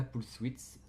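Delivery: English term in a rough French accent; accent français en anglais. Real, not acted. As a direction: say "pulse width" with a clumsy French accent. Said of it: "mon accent anglais est dégueulasse". (accent français approximatif)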